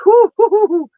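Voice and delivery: high, loud "whoo hoo hoo" to let feeling out; emotional whoo exhale. Real, not acted. Mother fighting tears on the podcast, high, loud whoo.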